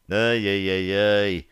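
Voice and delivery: slow, drawn-out groan of pain; forced out on a hard breath; laboured exhale. A narrator's reading. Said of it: "с трудом выдохнул я". (с трудом, на выдохе)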